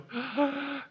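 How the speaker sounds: sharp, comic intake of breath; mock gasp of surprise. Pastor voicing God, mock shocked gasp. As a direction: gasp like you just heard shocking news.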